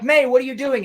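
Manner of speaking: high, loud startled question; hammed-up shock at being trapped; mock alarmed cry. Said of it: "And he's like".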